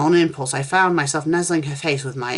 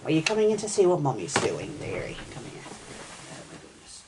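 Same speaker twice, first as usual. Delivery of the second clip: hushed aside; 9 dB quieter than usual. Real, not acted. Reading interrupted by her child.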